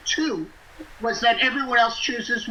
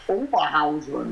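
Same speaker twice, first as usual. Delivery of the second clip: "Oberhausen" in a German accent, lower; 6 semitones lower than usual. Shown as a demonstration. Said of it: "you gotta say it with a German accent".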